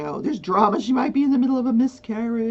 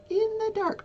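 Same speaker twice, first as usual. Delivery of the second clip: high echo; 14 semitones higher than usual. Acted. Echo repeat.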